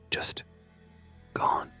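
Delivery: ordinary delivery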